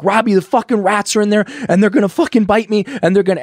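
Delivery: high, loud, fast panicked rush; panicked pleading. Retold or imitated. Telling his sponsor about rats, frantic and loud. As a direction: blurt it out in terror of the rats.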